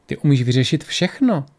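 ordinary delivery

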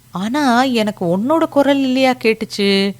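high-pitched sceptical question; doubtful neighbour. A narrator's reading. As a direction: query a friend's story, unconvinced. Neighbour doubts Lakshmi's explanation.

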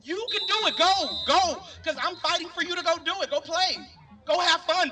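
high, rousing cheer-on; urging a young marcher to go dance; eager urging. Retold or imitated. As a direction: push them forward, fired up. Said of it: "it's for us to sometimes say".

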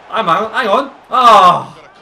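high, loud urging into a big cry; excited alarm. Real, not acted. Player as an attack fails, excited loud cry. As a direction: build to a loud "oh". Attack builds then misses.

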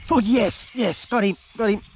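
high, delighted cry of triumph; excited cheer. Real, not acted. Angler celebrating a fresh catch, high and delighted. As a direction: cheer the hook-up. Landing another fish.

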